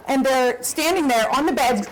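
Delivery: ordinary talk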